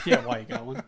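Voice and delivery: squeaky giggling parrot of the quote; laughing high echo. Retold or imitated. Friend echoes the quip, laughing.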